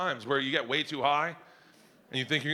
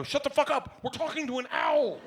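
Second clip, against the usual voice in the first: higher, mock-impatient snap; snapping at daughter; 9 semitones higher than usual. Dad snapping at his daughter to wait, mock impatience. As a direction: brush her off mid-owl chat.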